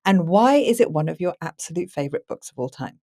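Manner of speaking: ordinary talk, nothing marked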